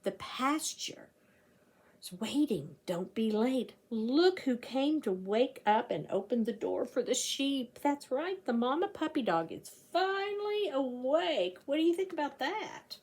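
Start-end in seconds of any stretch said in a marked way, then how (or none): none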